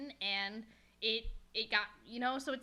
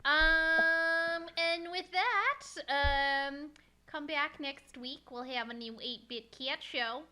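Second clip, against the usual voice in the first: drawn-out sign-off; 4 semitones higher, 4 dB louder, slower than usual. Real, not acted.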